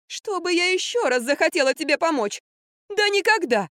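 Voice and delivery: high, offended outburst; indignant squeal. A narrator's reading. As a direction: squeal a refusal to help. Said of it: "взвизгнула Катя". (высокий обиженный взвизг)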